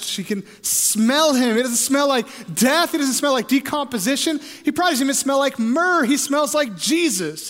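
high, loud, excited pulpit cadence; exultant, driving preacher; full-force preaching. Real, not acted. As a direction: preach it with mounting joy.

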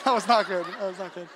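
amused apology through laughter; real embarrassed chuckle in the words; laughing. Real, not acted. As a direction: say it while cracking up. Laughing at own unfortunate visual.

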